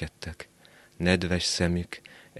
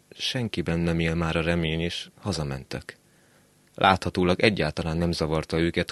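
plain reading voice

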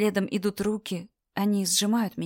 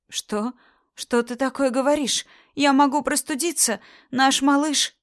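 higher, whiny protest; high pleading wife; 7 semitones higher, 4 dB louder than usual. Acted. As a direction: plead with a squeaky whine.

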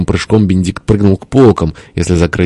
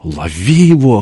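slightly raised, rasped command; hoarse cry; 4 semitones higher than usual. A narrator's reading. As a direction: rasp out the order to catch him. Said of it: "хрипел тесть". (хрипло, чуть повышенным голосом)

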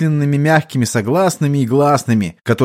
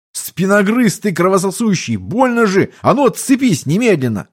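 high-pitched indignant scolding; pained yelling; 7 semitones higher than usual. A narrator's reading. Bitten man ordering child to let go, yelling. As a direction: protest sharply, in pain. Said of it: "оставалось только орать".

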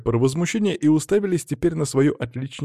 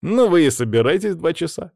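higher, dismissive retort; scoffing; 6 semitones higher, 4 dB louder than usual. A narrator's reading. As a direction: scoff at the others. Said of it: "хмыкнул Федя".

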